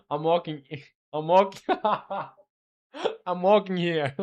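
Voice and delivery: laughing through a New Yorker "I'm walking here"; giggly New York street tough, lightly put on; playful New Yorker accent broken by laughter. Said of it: "like a New Yorker accent".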